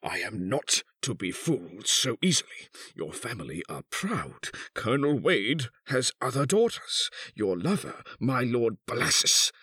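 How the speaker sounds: swings from controlled to high outburst; furious threat. A narrator's reading. Husband threatens to expose his wife, furiously. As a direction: threaten her, building to a high climax. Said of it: "he cried in sudden fury".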